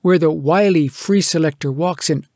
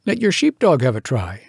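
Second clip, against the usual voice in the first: higher, drily mocking advice; raised, sardonic lecturing voice; wry scientist; 6 semitones higher than usual. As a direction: humour the fool. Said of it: "Said the scientific person".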